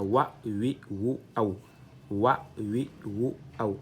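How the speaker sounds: "wa, wi, wu, aou" each said distinctly; teacher drilling syllables; Arabic vowel drill. Shown as a demonstration. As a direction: chant the series slowly. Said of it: "ça donne aou".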